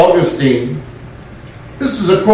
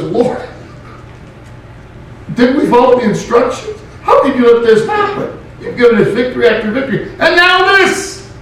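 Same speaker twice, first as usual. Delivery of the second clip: mock anguished plea; 6 semitones higher, faster than usual. Joshua complains to God.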